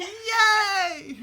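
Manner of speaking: loud, high celebratory shout; excited cheer. Real, not acted. Host celebrating the surprise call with winner, loud cheer. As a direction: shout it out in triumph.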